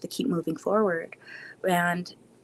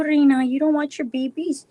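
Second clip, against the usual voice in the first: ordinary delivery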